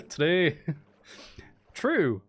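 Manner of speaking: put-on punchy amused Scottish-leaning accent; Scottish-sounding accent attempt. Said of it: "That's going more Scottish".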